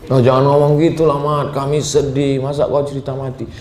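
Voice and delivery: pleading protest. Retold or imitated. Friends protest his talk of death.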